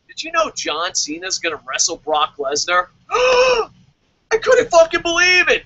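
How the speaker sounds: sarcastic overblown surprise with a gasp; mock shock. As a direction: fake astonishment over old news. Sarcastic fake shock at old news.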